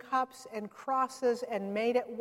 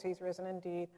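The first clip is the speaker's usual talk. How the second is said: low, soft, without conviction; half-hearted response; 5 semitones lower, 10 dB quieter, faster than usual. Retold or imitated. Friend reluctantly answering the Easter greeting by phone, half-hearted. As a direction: mumble it back like you don't mean it. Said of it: "Say it like you mean it".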